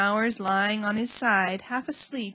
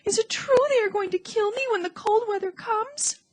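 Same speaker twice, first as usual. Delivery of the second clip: crying panic; 12 semitones higher, 5 dB louder than usual. A narrator's reading. Wilbur begs, crying.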